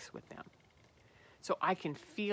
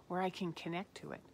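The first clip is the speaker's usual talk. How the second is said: normal talking voice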